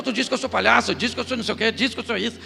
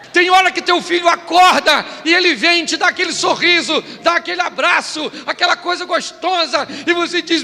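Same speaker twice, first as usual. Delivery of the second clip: preaching shout; 6 semitones higher, 7 dB louder than usual. Acted. Preacher shouting at the congregation about money. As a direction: hammer it at the congregation.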